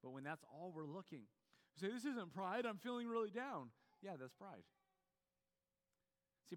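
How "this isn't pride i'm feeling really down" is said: protesting. Acted. Acts out protest against pride.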